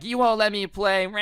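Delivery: put-on loud, high kid whine ending in a growl; whiny little brother. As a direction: imitate a kid throwing a fit. Imitating whiny little brother protesting.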